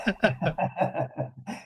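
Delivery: a light, airy burst of laughter; amused laugh after a quick no; real laugh. Real, not acted. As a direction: let a laugh slip out. Laughs after his own answer.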